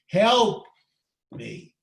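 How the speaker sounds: a sudden loud, high cry of help. Acted. Praying person at the end of their strength, crying out to God. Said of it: "you keep crying for help".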